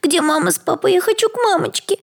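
put-on a little girl begging for her mother in fear; scared little girl. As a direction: cry for mummy like a terrified small child. Frightened little girl cries.

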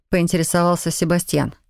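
ordinary delivery, nothing marked

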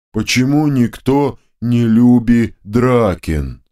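very slow, dim, hurt drone; a simple-minded giant sulking; the left head Draken sulks, slow and hurt; hurt, droning ogre head. A narrator's reading. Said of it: "обиженно прогудела вторая голова".

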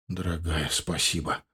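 low, very soft voice; tender thanks. Acted. Husband thanking his wife for two sons, tender thanks. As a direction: murmur gratitude to a loved one.

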